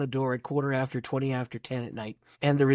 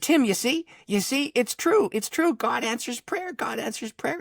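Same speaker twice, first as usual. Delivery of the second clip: high, excited imitation of a wife; retold breathless-with-wonder excitement; elated wife; 10 semitones higher than usual. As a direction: insist in a high voice, thrilled and vindicated. Voices wife elated at answered prayer.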